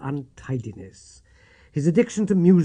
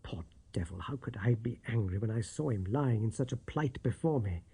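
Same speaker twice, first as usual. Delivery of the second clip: ordinary delivery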